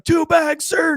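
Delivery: high, sung-out group response; recruits' chorus. Retold or imitated. Recruits answering their drill sergeant in unison, called out. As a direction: call it back like an obedient recruit.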